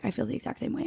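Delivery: breathy retold whisper. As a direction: whisper it like a secret. Retold text reply, whispered.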